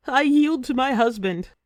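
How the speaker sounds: a narrator's yielded warrior cry; mock scream of defeat. A narrator's reading. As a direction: shout it out as she gives in. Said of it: "she screams".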